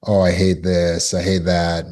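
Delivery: ordinary talk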